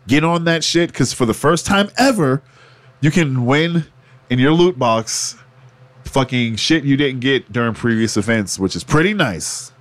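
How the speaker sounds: ordinary talk, nothing marked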